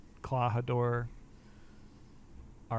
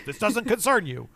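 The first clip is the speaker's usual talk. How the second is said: high, mock-tough retold line; brusque dismissal; 8 semitones higher, 4 dB louder than usual. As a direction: lightly act out a fighter waving someone off. Retelling Zoro's brusque dismissal.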